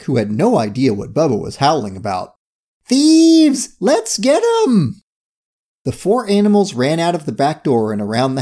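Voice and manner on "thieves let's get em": very high, excited call; called-out rallying cry. A narrator's reading. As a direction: squeal out a drawn-out battle cry. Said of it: "Curie called out to Kojak".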